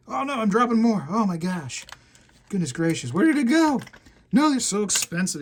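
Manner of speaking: panicking over dropped gold; real alarm. Real, not acted. Dropping expensive gold nuggets.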